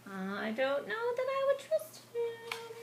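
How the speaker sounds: high, slow, doubtful whine; wary aside. Real, not acted. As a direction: say it like you smell trouble.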